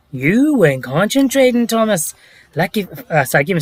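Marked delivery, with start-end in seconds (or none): 0.1-2.1 lightly put-on narrator voice, higher; a hint of a storybook narrator impression; Michael Angelis. Shown as a demonstration. Attempting a requested narrator impression, lightly done. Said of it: "Let's hear your Michael Angelis impression".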